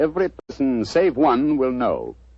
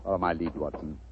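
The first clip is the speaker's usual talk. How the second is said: ordinary talk, nothing marked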